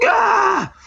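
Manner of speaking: very high, loud fanboy scream; mock fanboy shriek. Imitating Oscar Isaac's thrilled high shriek on stage. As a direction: shriek like a thrilled fan jumping up. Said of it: "he's like".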